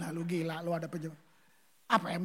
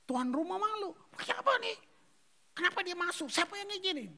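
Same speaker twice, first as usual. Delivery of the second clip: high, outraged host demanding answers; embarrassed host; 11 semitones higher than usual. Acted. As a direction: play the flustered host, voice up high. Embarrassed host demands who let her in.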